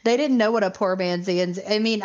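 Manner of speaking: ordinary talk, nothing marked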